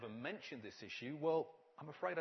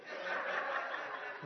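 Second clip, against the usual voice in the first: breathy laugh; 6 dB louder than usual. Real, not acted. Laughs at his own joke.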